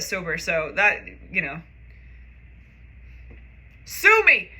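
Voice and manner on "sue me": defiant shout. Real, not acted. Defiant outburst after drunk confession.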